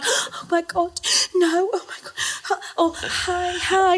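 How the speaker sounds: high, soft flustered greeting; mock shock. Imitating contestants meeting an ex, mock shock. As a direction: act shocked, high and hesitant. Acts the usual beach reaction.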